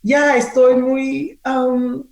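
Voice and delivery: high, slow, halting estoy muy; halting Spanish. Retold or imitated. Host imitating [name]'s halting Spanish at a press conference. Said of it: "chopped up Spanish".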